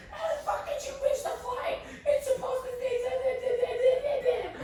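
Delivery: shrieking high mock freak-out; freaking out parents. Sister calling parents who shriek at her, high mock freak-out. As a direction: screech it like panicking parents, way up high. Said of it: "they're literally freaking out on us".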